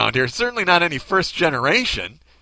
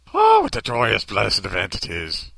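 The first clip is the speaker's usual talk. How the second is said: cartoon Irish accent; 5 semitones lower than usual. Priest rejoicing at Christmas mass, cartoon Irish accent. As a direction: gush it in a bad stage-Irish brogue. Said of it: "over the top Irish cartoon accent".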